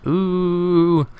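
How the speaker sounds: high drawn-out ooh; chorus of ooh; mock ooh. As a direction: tease like a crowd reacting. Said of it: "everyone in voice chat just went".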